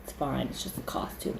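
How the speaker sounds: low, soft, dropping to a whisper; relieved hush. Retold or imitated. Showing relief at a costume, hushed. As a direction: let the scare go under your breath. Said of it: "and then been like".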